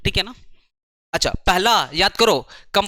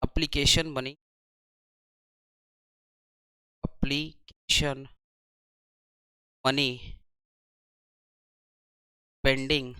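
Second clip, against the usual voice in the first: word by word, long pauses, softer; slow dictation; 5 semitones lower, 8 dB quieter, slower than usual. Shown as a demonstration. Teacher dictating the B entry to students, slow and softer. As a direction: dictate it slowly so everyone can write. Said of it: "अब इसको लिखेंगे B".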